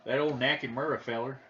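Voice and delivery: put-on folksy Southern accent. Friend joking about a wrestler in a put-on southern accent. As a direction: play it as a Southern good old boy. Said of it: "with a southern accent".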